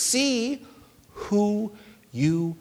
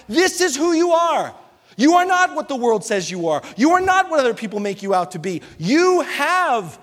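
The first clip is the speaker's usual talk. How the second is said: a touch louder, preaching God's words; 4 semitones higher, 3 dB louder than usual. Acted. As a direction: proclaim it to the church.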